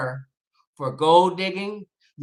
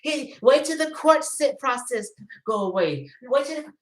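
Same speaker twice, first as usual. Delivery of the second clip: higher, prissy mock voice with a laugh; mocking cautious fan; 7 semitones higher than usual. Mocking viewers who defend R. Kelly, higher prissy voice. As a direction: parody the pearl-clutching defender of R. Kelly. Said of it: "Did you say".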